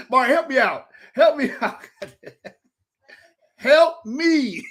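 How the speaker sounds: helpless, laughing cry for help; laughing plea. Real, not acted. Begging co-host for help, cracking up.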